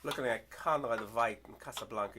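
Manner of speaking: usual speaking voice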